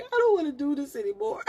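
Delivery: high-pitched retold whimper; mock crying. Laboring mom pleading with the nurse, mock crying. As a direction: whine it like a woman giving up mid-labor. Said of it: "I was crying".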